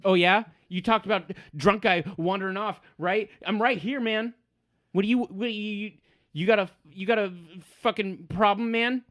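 high, loud, heated challenge; belligerent halfling. Acted. Halfling confronting the men gossiping about him, belligerent.

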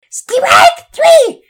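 very high, piercing shouted call; screamed ballgame call; umpire's shriek. A narrator's reading. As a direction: shriek the call at full volume. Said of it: "only heard the umpire shriek".

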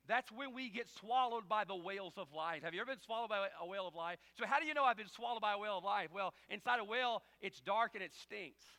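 loud, high preaching voice; fiery sermon delivery; full-force preaching. Acted. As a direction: preach it hard to the crowd.

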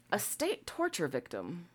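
soft, slow repeat of a quoted phrase; pointed repeat. Real, not acted. Host re-reads an odd phrase from the article, pointed and hushed. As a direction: say it slowly, dropping the volume.

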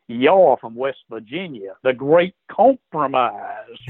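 slow, drawn-out Georgia drawl; imitated Georgia accent. Georgia woman addressing a West Virginian, imitated Southern accent. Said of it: "she said it with that accent".

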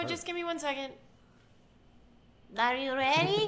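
lightly voiced impatient cashier; imitating cashier. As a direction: mimic her pushing the customer. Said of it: "I'll do her".

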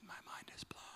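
breathless hushed whisper; a hushed, stunned aside; awed whisper. Retold or imitated. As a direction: whisper it in awe. Awed reaction to acronym.

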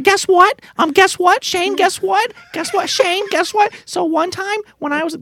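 very high, squeaky child voice imitation; excited little kid. Kid trying to tell Shane a story, excited child voice. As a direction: pester like a kid bursting to tell. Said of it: "that's how that story would start".

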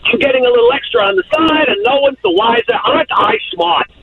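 high-pitched mock self-congratulation; imitated smug cheater. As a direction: lightly mimic a cheater pleased with getting away with it. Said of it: "And they're thinking".

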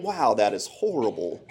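usual speaking voice

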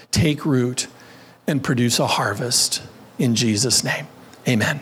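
low, earnest prayer voice. Acted. Pastor praying over the congregation, earnest. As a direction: pray over them, steady.

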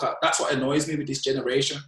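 ordinary talk

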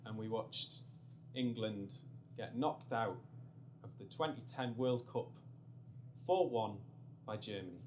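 ordinary delivery

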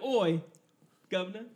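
loud, hammed-up Cockney accent, a botched stand-in for French; barking a Cockney Oi at a friend; mock Cockney accent. Mocking a French teacher with a loud Cockney accent. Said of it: "I can't fake a French accent".

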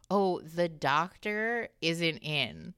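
very slow, drawn-out receptionist act; slow, measured mock-official reply; pretend receptionist. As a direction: imitate someone stalling as the receptionist. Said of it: "pretended like she was the receptionist".

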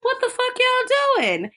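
high-pitched indignant demand; gym teacher's outrage. Retold or imitated. Gym teacher walks in on the chanting girls, high mock outrage. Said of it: "the gym teacher comes in like".

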